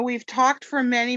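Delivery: usual speaking voice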